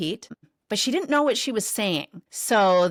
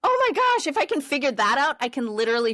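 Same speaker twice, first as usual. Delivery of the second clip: high loud squeal settling to usual pitch; retold elated squeal; 6 semitones higher, 4 dB louder, faster than usual. Daughter realizes she can figure out anything, imitated elation. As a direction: imitate a thrilled kid, then ease down. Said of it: "we were squealing together".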